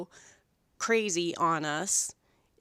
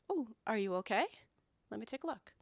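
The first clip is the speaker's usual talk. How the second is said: soft, hushed reassurance; gentle concern; 9 dB quieter, faster than usual. Retold or imitated. Groomer checks on a yelping dog, soft and caring. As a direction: soothe a startled dog quietly. Groomer answers a small yelp.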